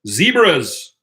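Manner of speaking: "Zebras" with a long, high EE, ZEE-bras; South African pronunciation. Shown as a demonstration. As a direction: draw out the first vowel of "Zebras" emphatically. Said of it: "know how to pronounce zebra correctly".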